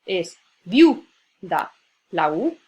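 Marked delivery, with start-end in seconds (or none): 0.0-1.1 viu punched much louder than the words around it; stress on viu. Shown as a demonstration. Teacher marking the stressed syllable for students, loud stress on viu. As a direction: hit the syllable viu hard. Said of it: "la vocal tónica".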